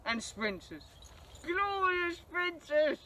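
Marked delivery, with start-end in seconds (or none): 1.5-3.1 high, slow gushing delight; excited. Real, not acted. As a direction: swoon over the trains. Gushing over favourite trains.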